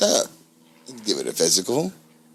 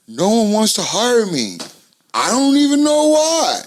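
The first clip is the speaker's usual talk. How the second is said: mock whiny complaint; 10 semitones higher, 4 dB louder than usual. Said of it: "sit there mad at the phone".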